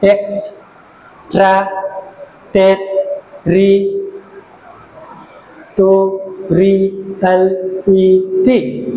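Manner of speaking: Extra টের রি টো রি এল ই টি, slow with short gaps; syllable by syllable. Shown as a demonstration. Teacher showing how many syllables are here, syllable by syllable. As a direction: chop the word into evenly separated syllables. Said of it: "এখানে কটা syllable আছে".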